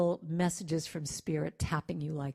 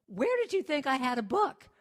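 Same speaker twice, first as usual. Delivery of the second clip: baffled retort; 7 semitones higher, 4 dB louder than usual. Retold or imitated. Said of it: "And I'm like".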